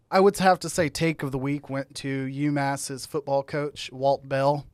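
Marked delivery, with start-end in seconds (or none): none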